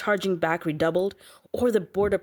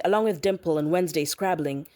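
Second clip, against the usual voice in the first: ordinary delivery, nothing marked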